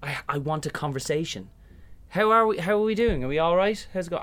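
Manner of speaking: high, loud friendly greeting; loud, high Irish small-talk opener; cheerful chat. Retold or imitated. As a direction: breeze in wanting a chat. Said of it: "I just stand there and I go".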